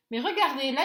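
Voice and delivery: normal talking voice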